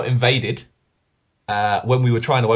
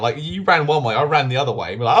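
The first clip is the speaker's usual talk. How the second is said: ordinary talk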